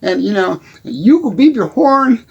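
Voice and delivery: normal talking voice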